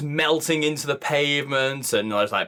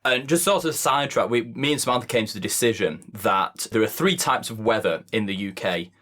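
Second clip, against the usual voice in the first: ordinary talk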